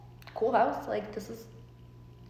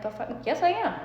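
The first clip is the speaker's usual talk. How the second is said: defiant; 7 semitones higher than usual. Retold or imitated. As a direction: fire back in disbelief. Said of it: "And I'm like".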